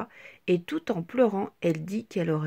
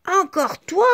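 higher, louder shout; angry cry; 8 semitones higher, 9 dB louder than usual. A narrator's reading. Petit Carré shouts angrily at Petite Tache. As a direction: yell at her. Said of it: "crie Petit Carré".

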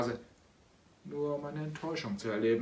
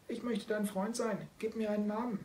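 kitten voice; 9 semitones higher than usual. Acted. Kitten character speaks. (hohe langsame Kätzchenstimme)

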